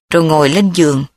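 usual speaking voice

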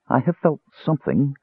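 lightly voiced breathless woman; breathless murmur. A narrator's reading. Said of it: "Cressania murmured breathlessly".

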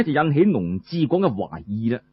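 ordinary delivery, nothing marked

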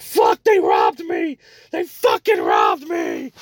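drawn-out mock scream of outrage; imitated yelling. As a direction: slowly wail it out like a robbed man yelling. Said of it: "He starts yelling".

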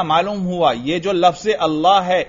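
ordinary talk, nothing marked

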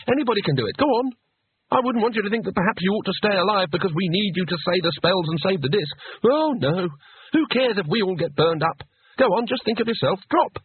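high-pitched mock encouragement; goading. A narrator's reading. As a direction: egg him on with climbing, scornful sarcasm. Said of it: "It was time for harsh measures".

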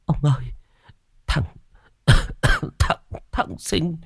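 put-on high, stammering terrified old woman; frightened stammer. A narrator's reading. Mrs Năm tells husband she saw Sinh, terrified stammer. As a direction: stumble over words in fright. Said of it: "giọng đầy sợ sệt".

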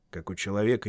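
ordinary delivery

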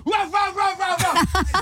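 high, rapid mock barks; dog barking. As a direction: bark like an excited guard dog. Imitates dog barking at door.

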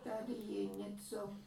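a granny boasting about her son's painting; proud old woman. A narrator's reading. Old woman voiced in story. (vyšší pomalý hlas pyšné stařenky)

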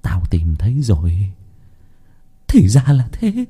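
awed self-talk, rising in pitch; muttering in dawning excitement. A narrator's reading. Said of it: "miệng lẩm bẩm".

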